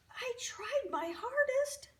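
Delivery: high, small voice close to crying; tearful child praying. A narrator's reading. Ronnie prays to Jesus about the undelivered present, tearfully sorry. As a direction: plead apologetically, high and sorrowful, with a sigh. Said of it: "Ronnie prayed".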